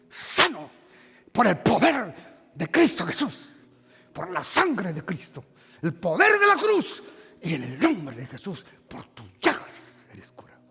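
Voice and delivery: preacher praying healing over the man; fervent healing prayer. Acted. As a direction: pray over the sick with fervor.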